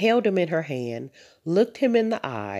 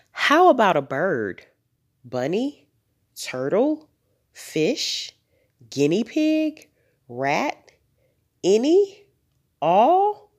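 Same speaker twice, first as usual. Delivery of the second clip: rapid-fire suggestions, each a rising question; last-ditch begging, pitch leaping up; desperate pleading; 6 semitones higher, 4 dB louder, slower than usual. A narrator's reading. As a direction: climb higher and more hopeful to a loud last plea. Girl desperately lists every pet.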